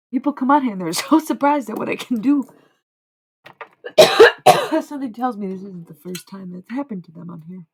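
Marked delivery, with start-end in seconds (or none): 3.4-4.8 sudden hard cough, choking; real coughing fit. Real, not acted.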